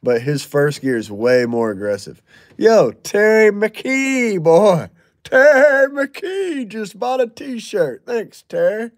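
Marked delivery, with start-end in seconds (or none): 2.6-8.9 slow, high, loud hype call for Terry McKee; hype shout-out. Real, not acted. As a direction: stretch it out like a ring announcer. Hyping a buyer on stream.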